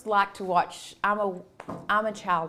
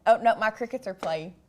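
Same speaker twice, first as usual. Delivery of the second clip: ordinary talk, nothing marked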